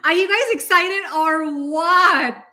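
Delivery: drawn-out excited question to viewers. Real, not acted. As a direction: pump up the crowd. Hyping audience about trip.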